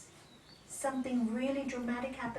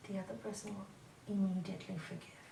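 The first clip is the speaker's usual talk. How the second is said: normal talking voice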